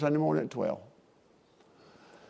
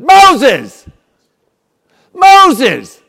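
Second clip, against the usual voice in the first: loud, high shout of the name; God's thundering call; booming call; 11 semitones higher, 17 dB louder than usual. Retold or imitated. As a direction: call out Moses with full force. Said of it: "He said".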